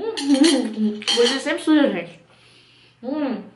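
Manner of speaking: talking while chewing mochi; muffled by sticky food; mouth full chewing. Real, not acted. Seen 1: bites mochi at -7, chews with cheeks full, hand at mouth.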